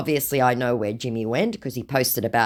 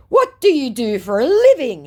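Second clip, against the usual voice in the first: mock scammer voice; 11 semitones higher, 7 dB louder than usual. Woman reading the scammer's message aloud, in a high mock voice. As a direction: ham up the creepy flattery.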